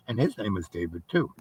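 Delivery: real hushed whisper. Real, not acted. Revealing the elder's name, real hushed whisper.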